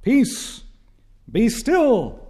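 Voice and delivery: high, ringing command over the storm; commanding voice of God. Acted. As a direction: call out to still the sea. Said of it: "and then he utters his voice".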